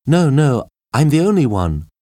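high female answer from afar; high, carrying reply; woman calling back. A narrator's reading. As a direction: call the answer back, pitched well up. Kathy calls back to Jane.

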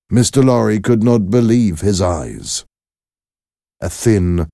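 ordinary delivery

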